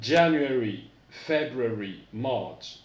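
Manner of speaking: January said loud, then February, March; teacher modelling for repetition; model English pronunciation. Shown as a demonstration. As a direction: clear English month names. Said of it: "Répétez après moi".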